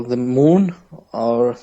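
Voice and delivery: ordinary talk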